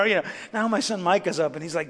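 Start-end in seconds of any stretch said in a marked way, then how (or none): none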